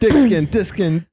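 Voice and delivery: giggling through the words; can't get it out for laughing. Real, not acted. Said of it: "I can't even say it".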